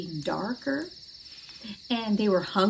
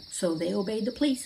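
usual speaking voice

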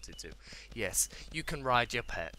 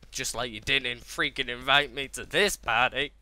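louder, indignant complaint; mock grievance; 7 dB louder than usual. Gamer uninvited to the party, mock indignant. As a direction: complain loudly as if left out.

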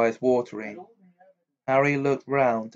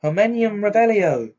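higher, louder spell cast; spell incantation; 9 semitones higher, 5 dB louder than usual. A narrator's reading. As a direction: call out the spell with force. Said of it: "raised her wand and said".

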